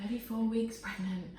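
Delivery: ordinary talk, nothing marked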